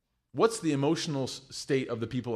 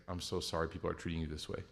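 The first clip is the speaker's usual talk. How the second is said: much softer and lower, gentle; tender apology; 7 semitones lower, 9 dB quieter, faster than usual. Retold or imitated. Mom to the airline agent, soft and sorry. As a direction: soothe a stressed worker kindly. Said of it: "she would say, like".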